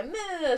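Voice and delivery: put-on a whiny little "Meh" like a cross two-year-old; hint of a tantrum bleat; imitated toddler whine. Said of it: "and then she'd go".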